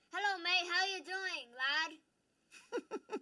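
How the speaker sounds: chummy put-on British accent; playful English lad accent. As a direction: greet a mate in a loud British accent. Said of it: "he's working on his English accent".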